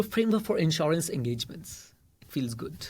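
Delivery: soft, slow imitation of a fussy correction; mock-refined meme voice. Teacher parodies the meme's preferred wording, mock-refined and soft. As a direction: say the grander term slowly and softly, savouring it. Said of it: "वो वाला meme बेटा".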